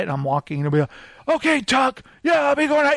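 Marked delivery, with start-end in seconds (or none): 1.4-2.9 imitating a yell. Deacon calling Tuck on the CB, mock yelling. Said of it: "he's, like, yelling like he's on the motorcycle".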